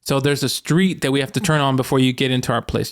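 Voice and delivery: ordinary talk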